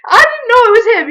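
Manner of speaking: high, loud imitation of a protest; hammed-up high-pitched plea of ignorance; mock protesting excuse. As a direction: mimic an indignant excuse, pitched right up. Exaggerated repeat of sister's excuse.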